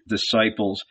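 plain reading voice

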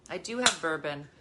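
normal talking voice

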